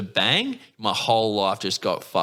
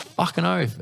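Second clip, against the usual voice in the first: higher-pitched eager reply; keen agreement; 9 semitones higher than usual. Retold or imitated. Tattooist to his first willing client, keen agreement. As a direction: jump at the offer, pitched up.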